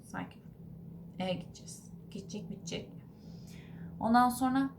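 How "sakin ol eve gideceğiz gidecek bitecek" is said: inner voice. Retold or imitated. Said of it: "Kafamdan diyorum ki".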